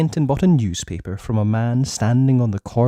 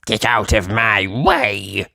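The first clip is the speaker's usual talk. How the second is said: rude snap; much slower than usual. Acted. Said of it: "snapped Mister Uppity rudely".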